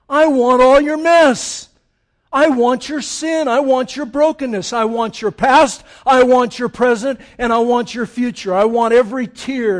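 loud, emphatic preacher voicing Jesus; forceful demand. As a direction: declaim each want with full force. Voices Jesus demanding everything, emphatic.

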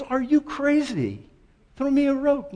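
high, disbelieving cry from the cliff; he thinks God is mad; incredulous hiker. Retold or imitated. Hiker dangling from a branch yelling up at God, high and incredulous. Hanging man argues with God.

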